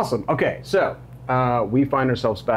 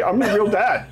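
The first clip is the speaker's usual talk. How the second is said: mock stepdad; 10 semitones higher than usual. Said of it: "that's what all stepdads say".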